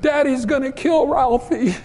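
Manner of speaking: lightly voiced worried mom coaxing the boy out; mother's hurried plea. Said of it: "she goes".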